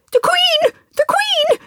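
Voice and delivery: high urgent cry, voice leaping up; calling out in alarm. Acted. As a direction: call out a warning, very high. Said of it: "called out".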